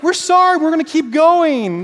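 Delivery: high, loud whiny apology; hammed-up sorry while carrying on; mock sheepish crew. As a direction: crew apologizing but not turning. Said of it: "They don't, like".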